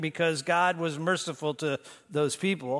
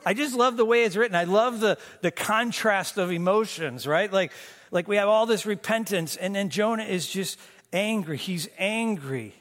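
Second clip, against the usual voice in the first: ordinary talk, nothing marked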